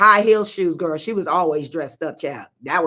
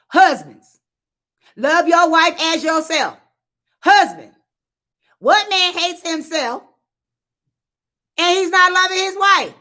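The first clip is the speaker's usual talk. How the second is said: building preaching; 10 semitones higher than usual. Real, not acted. Calling out the husbands from the pulpit, preaching higher and higher. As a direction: preach it, climbing in pitch.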